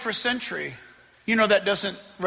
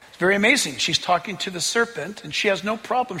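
ordinary talk

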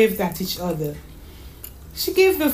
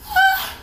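put-on very high child's gasp; sharp, squeaky intake of amazement; astonished gasp. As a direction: gasp in delighted surprise. Child sees another surprise dish.

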